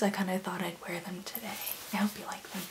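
whisper. Real, not acted. Aside about her glasses.

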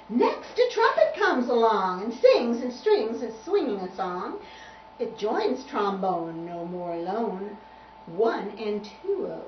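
put-on lively rhythmic verse for a child; bouncy picture-book rhyme; poem reading. A narrator's reading. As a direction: swing through the rhyme, punching the first word. Reads rhyming picture book.

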